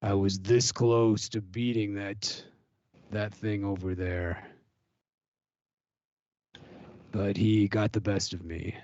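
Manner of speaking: low, ominous warrior voice; ominous fighter voice. Acted. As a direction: brood over it like a grim gladiator. Gladiator character voice.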